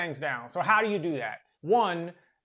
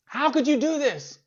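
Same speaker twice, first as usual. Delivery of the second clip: high, raised mock yell at the teammate; mock scream; 8 semitones higher, 3 dB louder than usual. Angry monk screaming at his teammate, mock yell. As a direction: cry it out like a betrayed monk. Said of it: "Like, screams".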